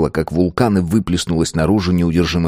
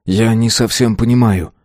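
plain reading voice

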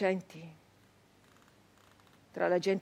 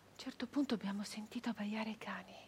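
suspense whisper; 11 dB quieter, faster than usual. A narrator's reading. Narrator whispering as the dogs are heard. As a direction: lean in and whisper the danger. Ominous turn in story.

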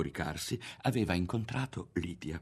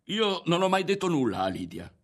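high, indignant raised voice; shouted denial; 9 semitones higher, 4 dB louder than usual. A narrator's reading. Ario denies having told Lidia anything, shouting. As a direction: protest your innocence, voice raised. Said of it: "gridò Ario".

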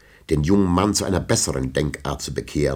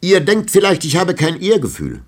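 higher, louder indignant cry; indignant outcry; 9 dB louder than usual. A narrator's reading. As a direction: burst out, stung to the quick. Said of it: "rief er".

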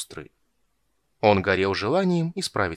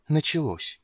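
higher, lightly alarmed, still voiced; slightly frightened whisper; 6 semitones higher than usual. A narrator's reading. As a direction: murmur it with a touch of fear. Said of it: "с лёгким испугом прошептал Хани". (с лёгким испугом)

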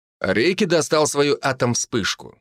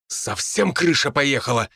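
barking; 4 semitones higher than usual. A narrator's reading. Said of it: "рявкнул он мне".

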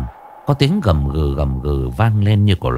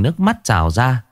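ordinary delivery

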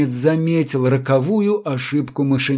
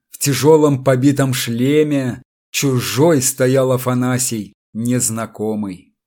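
plain reading voice

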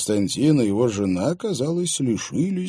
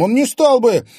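high, loud heated denial; heated exclamation; 11 dB louder than usual. Acted. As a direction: fire it back hotly. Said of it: "горячо воскликнул Клим".

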